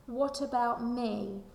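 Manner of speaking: plain reading voice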